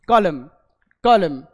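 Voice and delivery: two loud model takes of कॉलम without N; silent N. Shown as a demonstration. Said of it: "एन silent". (कॉलम ज़ोर से, न के बिना)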